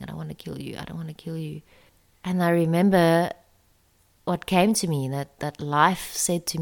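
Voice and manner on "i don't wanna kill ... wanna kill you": much softer, pleading murmur. Retold or imitated. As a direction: apologize softly to the spider. Talking to spider before killing.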